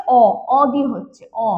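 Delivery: stretched open অ sound; vowel o sound. Shown as a demonstration. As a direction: hold the open অ sound clearly. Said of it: "উচ্চারণটা কি হচ্ছে". (অ ধ্বনি টেনে দেখানো)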